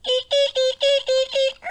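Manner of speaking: high squeaky hinge creak; rusty hinge squeak. Acted. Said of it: "That's the way they sounded".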